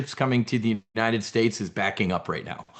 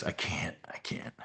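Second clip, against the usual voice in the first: whispered, voice giving out; barely a whisper, overcome with disgust; breathless whisper; 6 semitones lower, 14 dB quieter than usual. Real, not acted. Commentator overwhelmed by a story, whispering he can't.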